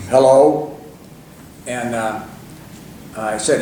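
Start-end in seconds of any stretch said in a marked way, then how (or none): 0.1-0.8 loud, resonant phone hello; deep-voiced man. Reg answering a fan's phone call, deep-voiced. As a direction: boom a short questioning hello. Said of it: "He had a very deep voice".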